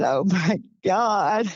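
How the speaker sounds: mock-tearful, overwhelmed disbelief; retold crying. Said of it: "he started crying. He said".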